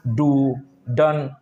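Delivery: डू, डन said very slowly with a hard retroflex D; slow example words. Shown as a demonstration. Teacher slowly sounding out example words for students. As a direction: drag out डू, डन, hitting the D hard. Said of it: "जैसे डॉग".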